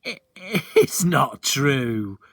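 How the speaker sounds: slow, laugh breaking into the words; a boy half laughing; starting to laugh. A narrator's reading. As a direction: giggle through it. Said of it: "starting to laugh".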